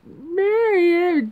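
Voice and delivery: high, drawn-out mock outcry; slow, high-pitched imitation of disbelief. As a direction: stretch it out high, imitating shock. Imitates Noelle's reaction to marriage.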